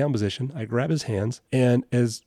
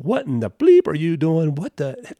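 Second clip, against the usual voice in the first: loud, raised mock yell at the officer; imitating a belligerent man swearing; angry cussing; 5 semitones higher, 4 dB louder than usual. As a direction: bark it like a furious suspect. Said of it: "mad, cussing me out".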